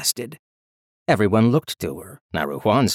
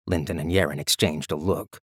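ordinary delivery, nothing marked

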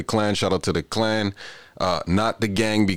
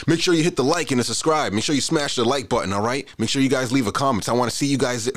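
YouTuber voice; 8 semitones higher, faster than usual. Pretend. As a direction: pump it up like a YouTuber begging for subs. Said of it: "Get into my YouTuber voice".

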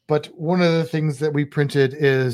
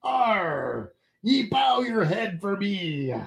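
slow growling pirate command; 4 semitones higher, much slower than usual. Pretend. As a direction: play a pompous pirate demanding a bow. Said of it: "It's like".

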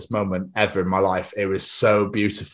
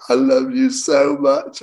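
mock tearful, drawn-out and louder; imitated emotional gushing; 4 semitones higher, 4 dB louder, slower than usual. Girlfriend overcome by her present, imitated emotional gushing. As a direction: imitate someone overcome, stretching out each word slowly. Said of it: "she was absolutely gone".

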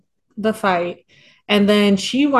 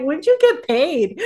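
slow, pitched-up incredulous question; skeptical; 5 semitones higher, slower than usual. Retold or imitated. Asking the character about his pay, slow and skeptical. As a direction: ask it like you don't buy it, slowly.

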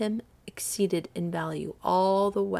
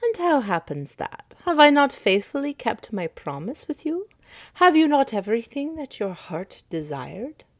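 higher, pressing stranger's voice; questioning stranger; 6 semitones higher, 5 dB louder than usual. Acted. Stranger reminding Midas of his promise, higher. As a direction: press him with the promise kept.

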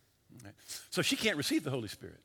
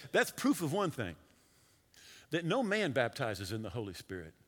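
ordinary delivery

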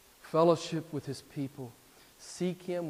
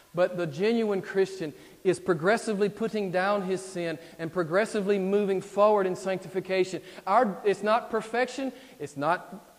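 loud, raised preaching voice; emphatic preaching; 6 semitones higher, 9 dB louder than usual. Real, not acted. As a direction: preach it with rising force. Preaches at full force.

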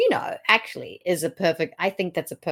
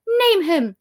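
high, louder demand; acted challenge; 12 semitones higher, 6 dB louder than usual. Lady Anne demanding the better man's name, acted challenge. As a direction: fire it back as Lady Anne.

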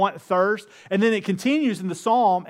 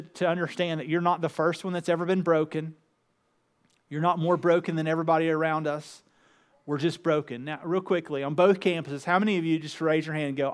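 usual speaking voice

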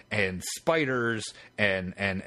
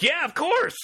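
high, gushing yes; eager agreement; 3 dB louder than usual. Retold or imitated. Researcher eagerly agrees to help the callers, high. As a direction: beam and agree right away.

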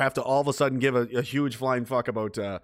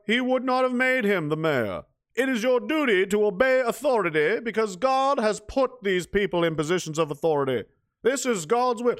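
radio preacher; 9 semitones higher than usual. Retold or imitated. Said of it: "the preacher, goes".

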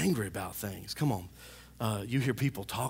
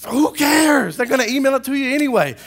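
high, loud, quickening retort; exasperated rebuttal; 11 semitones higher, 11 dB louder than usual. Acted. Pastor dismissing the receipt complaint, exasperated yell. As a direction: throw it back in exasperation.

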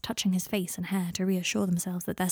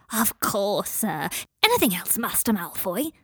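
eager house-elf; slower than usual. Acted. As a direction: answer like an overeager elf. Kreacher eager to serve.

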